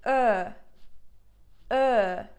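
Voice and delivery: "Ö" with lips in a small circle pushed forward; Turkish ö demo. Shown as a demonstration. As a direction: say "Ö" like her with rounded lips. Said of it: "the circle is just smaller".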